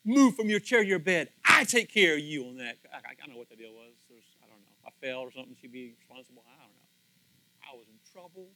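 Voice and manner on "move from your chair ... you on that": mad nurse. Retold or imitated. Nurse scolding the pastor for moving to bed, loud and stern. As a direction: scold him for leaving the chair. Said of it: "She was so mad at me".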